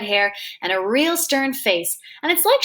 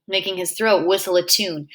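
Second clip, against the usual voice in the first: ordinary delivery, nothing marked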